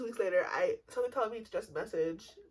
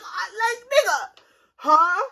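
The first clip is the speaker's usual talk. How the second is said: high, loud disbelief with a crying laugh; can't believe it, voice cracking up; loud laughing outrage; incredulous outburst; 11 semitones higher, 11 dB louder, slower than usual. Real, not acted. Outraged at his new girl.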